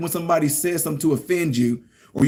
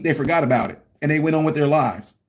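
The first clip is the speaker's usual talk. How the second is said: ordinary talk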